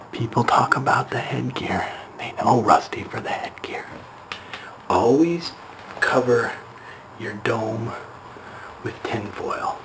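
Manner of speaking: secretive whisper fading out; hushed secret advice; whispering while hiding. Pretend. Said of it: "I'm hiding".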